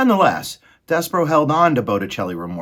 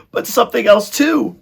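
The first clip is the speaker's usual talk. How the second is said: high, loud rat voice rising higher; excited rat; 9 dB louder than usual. A narrator's reading. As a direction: chime in shrieking as an eager rat. Excited rats call out for prey.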